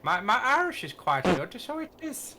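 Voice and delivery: jokey Irish lilt rising at the end; bad Irish accent. Boasting to teammates about his Irish, bad Irish accent. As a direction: put on an unconvincing Irish accent. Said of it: "That is not Irish".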